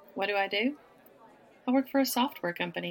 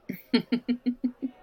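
coy giggle; 5 semitones higher, 5 dB louder than usual. Acted. Coy teasing at bar.

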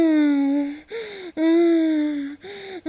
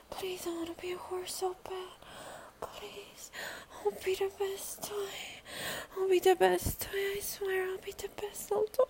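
hushed begging whisper; 12 dB quieter than usual. Real, not acted. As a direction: plead in a desperate whisper.

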